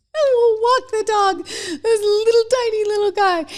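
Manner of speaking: squeaky doting voice for a little dog; cutesy high voice. Acted. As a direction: coo it like talking to a tiny pup. Husband walks tiny dog.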